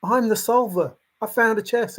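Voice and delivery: hammed-up indignant solver; mocking a searcher insisting they found it; mock protest. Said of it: "And you're like".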